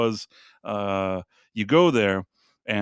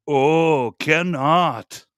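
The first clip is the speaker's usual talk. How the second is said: a touch louder mock Thai seller; imitating Thai vendor; 4 dB louder, much slower than usual. Tourist using the seller's own refusal back on him, mock Thai accent. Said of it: "I tell him".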